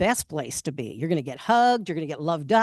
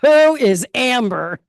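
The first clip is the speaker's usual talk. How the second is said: loud, slow, jealous yell; yelled question; 6 semitones higher, 8 dB louder, slower than usual. Retold or imitated. Girlfriend in the back seat yelling at boyfriend, loud and slow. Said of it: "she yells out from the back".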